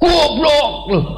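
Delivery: high-pitched, drawn-out mock-angry shout; angry yell. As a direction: yell the insult like someone who has lost their temper. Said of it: "Deket tapi teriak". (teriakan marah)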